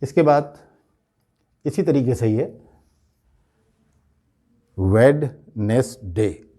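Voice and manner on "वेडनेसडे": "वेडनेसडे" very slowly, VED, nes, day; slow three-part "वेडनेसडे" as spelt; common mispronunciation. Shown as a demonstration. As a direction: sound the first d in "वेडनेसडे". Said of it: "आम तौर पे लोग इसको इस तरह से".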